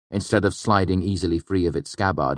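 usual speaking voice

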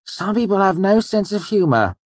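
high, theatrical sighing complaint; showy mock-weary dismissal; affected nonchalance with a sigh. A narrator's reading. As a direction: feign airy unconcern, loudly put-upon. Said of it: "he said with a sigh".

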